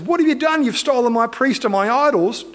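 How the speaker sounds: high-pitched mock outrage; acted indignation. Micah runs out at men who stole his priest, indignant. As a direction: play the wronged man protesting in disbelief. Robbed Micah confronts the thieves.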